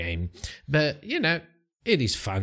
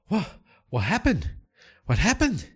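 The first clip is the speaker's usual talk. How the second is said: high bewildered cry of a man just revived; startled, lost, asking twice; confused waking; 9 semitones higher than usual. Acted. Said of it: "gets himself up and goes".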